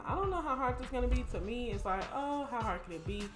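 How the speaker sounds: ordinary talk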